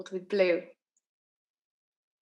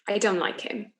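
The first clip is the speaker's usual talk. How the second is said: plain reading voice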